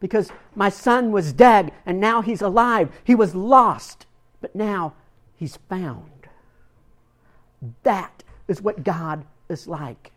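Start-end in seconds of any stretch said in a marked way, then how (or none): 0.0-6.3 joyful command. Retold or imitated. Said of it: "he tells his servant".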